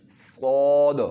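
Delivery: صاد loud and full, with a hiss at the start; full-voiced صاد with an opening whistle; heavy saad. Shown as a demonstration. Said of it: "شروع میں آپ تھوڑی سی سیٹی کی آواز نکالیں".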